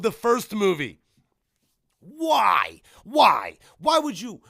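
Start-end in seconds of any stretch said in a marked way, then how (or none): 2.0-4.4 loud, drawn-out pleading protest; high, hard-hit exasperation; exasperated wail. Real, not acted. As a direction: cry out in fan outrage, louder each time. Lamenting the movie's choice.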